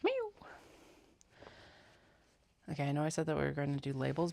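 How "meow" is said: high meow. Real, not acted. Calling cat.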